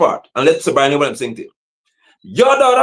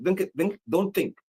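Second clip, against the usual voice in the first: much softer, low and threatening; quiet angry warning; quiet menace; 6 semitones lower, 11 dB quieter than usual. Real, not acted. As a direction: drop to a dangerous hush. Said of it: "I'm very angry".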